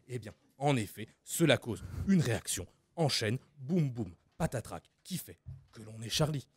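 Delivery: Jamy impression. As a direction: explain gravely like a science show host, with sound effects. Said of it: "Fred et Jamy".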